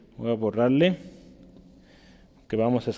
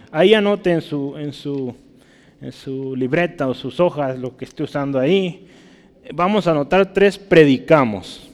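ordinary talk

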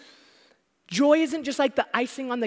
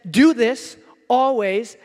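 each word slowed and louder; slow emphatic command; 7 dB louder, much slower than usual. Retold or imitated. Preacher stressing the command's wording to listeners. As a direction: lean hard on every word. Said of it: "that says".

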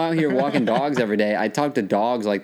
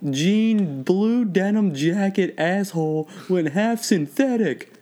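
high, slow mock announcement; drawn-out high-pitched heckle; jeering mechanic; 9 semitones higher, slower than usual. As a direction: call the others over to ridicule an idiot, hammed up. Acting out mechanics mocking a customer.

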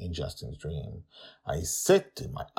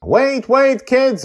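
put-on high loud yell after the kids; 15 dB louder, slower than usual. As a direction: call them back sharply. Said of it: "I yell at them".

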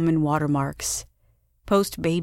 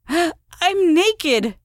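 much higher, louder shriek; 12 semitones higher, 5 dB louder than usual. A narrator's reading. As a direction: shriek it in sudden alarm. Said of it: "Wren interrupted with a shriek".

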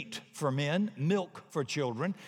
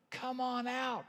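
calling out; 9 semitones higher, 5 dB quieter, slower than usual. Acted. Preacher calling people out.

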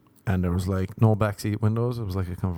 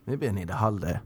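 ordinary talk, nothing marked

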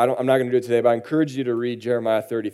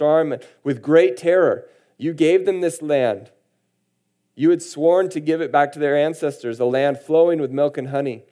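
raised, earnest Bible reading voice; scripture reading; 5 semitones higher, 4 dB louder than usual. Acted. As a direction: proclaim the verses to the congregation. Reads scripture aloud in sermon.

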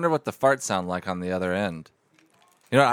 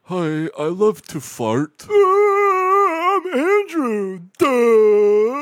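high, slow, whiny goofy voice; silly high voice; 8 dB louder, much slower than usual. Acted. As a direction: stretch the words out like a cartoon dimwit. Silly prank caller voice.

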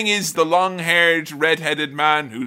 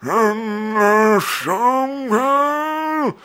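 put-on slow, high, strained old king voice; croaky old king; 7 semitones higher, much slower than usual. Old king Theoden snapping at the wizard, slow high strained voice. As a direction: rasp it out like a withered king. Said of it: "And he's like".

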